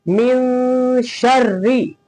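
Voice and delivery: very slow "मिन शर्री", the n held as a nasal hum; ghunna. Shown as a demonstration. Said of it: "ऐसे गुन्ना करके".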